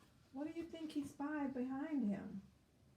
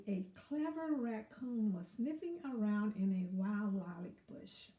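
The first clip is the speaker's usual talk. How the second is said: ordinary delivery, nothing marked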